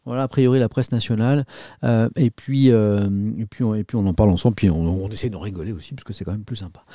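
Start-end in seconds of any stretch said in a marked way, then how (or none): none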